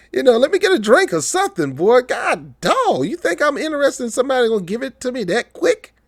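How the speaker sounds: high, incredulous exclamation; hammed-up high-pitched disbelief; incredulous retort. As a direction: play it up like a man shocked at a quick offer. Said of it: "I'm like".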